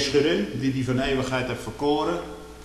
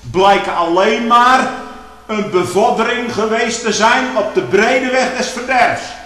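loud, higher fervent pulpit preaching; preaching at full force; 7 semitones higher, 7 dB louder than usual. Acted. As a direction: lift the congregation with rising fervour. (luide, vurige preek)

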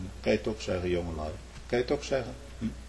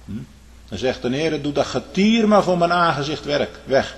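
normal talking voice

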